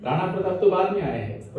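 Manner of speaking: usual speaking voice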